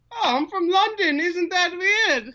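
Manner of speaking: high, hammed-up mock London accent; fake London accent. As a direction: put on a made-up British London accent for a laugh. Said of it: "I don't even know what accent I did".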